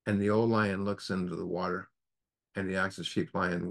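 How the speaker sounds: normal talking voice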